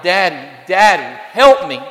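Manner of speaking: put-on trapped boy calling for help, high and loud; child crying out. Little boy trapped upstairs calls to his father, high and loud. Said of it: "And he's crying out".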